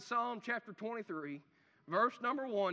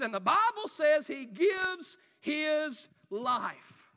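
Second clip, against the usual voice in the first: slow, loud proclaiming shout; preaching shout; 5 semitones higher, 7 dB louder, much slower than usual. Acted. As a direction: cry it out slowly from the pulpit.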